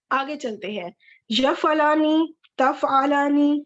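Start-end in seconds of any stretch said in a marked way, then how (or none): 1.3-3.6 یفعلانی and تفعلانی said slowly and carefully, stressing the آنی ending; slow drill word. Shown as a demonstration. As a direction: spell out تفعلانی slowly for students. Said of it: "یہاں پہ بھی آنی کی sound".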